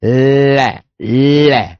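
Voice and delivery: teacher sounding out ले for the class; ले demonstrated. Shown as a demonstration. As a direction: say ले with the tongue up and air passing the sides. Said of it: "जो ले है".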